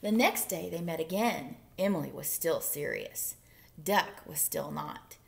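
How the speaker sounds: usual speaking voice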